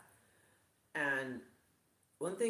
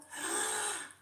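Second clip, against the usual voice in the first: high, hammed-up gasp of delight; fake excited gasp. Shown as a demonstration. Vlogger faking wonder in her put-on voice, gasp. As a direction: gasp like a thrilled influencer. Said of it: "let me try and do it".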